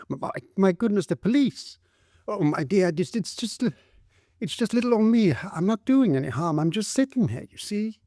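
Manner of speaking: high, meek and startled; small frightened voice, apologising; timid young woman. A narrator's reading. Woman caught by police pleads her innocence.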